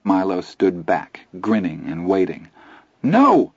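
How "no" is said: high sudden shout. Acted. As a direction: yell to stop him. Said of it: "I shouted".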